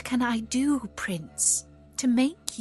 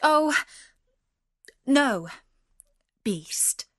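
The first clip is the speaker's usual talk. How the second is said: high, loud start dropping away; hasty refusal; 4 semitones higher, 4 dB louder, much slower than usual. A narrator's reading. Beauty refusing the beast's proposal, hasty and alarmed. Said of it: "said Beauty hastily".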